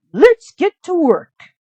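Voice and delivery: high, loud squeaky mouse alarm; a little mouse crying out in alarm; high, urgent cartoon mouse; Gus the mouse. Gus voiced.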